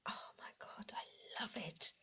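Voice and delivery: excited breathless whisper. Real, not acted. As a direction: whisper it in delight. Delighted find.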